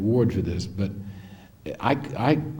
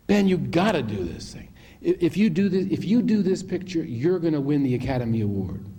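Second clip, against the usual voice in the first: higher-pitched urging; insistent retold plea; 6 semitones higher than usual. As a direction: press him hard, re-enacting the plea. Retelling his final plea to Ben.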